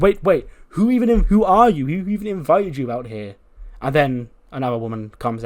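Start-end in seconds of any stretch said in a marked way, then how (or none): 0.0-3.4 indignant demand. Retold or imitated. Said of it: "And then Charlotte's like".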